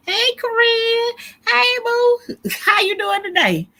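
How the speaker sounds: sing-song welcome to a friend; excited greeting. Real, not acted. Greeting a chat member by name.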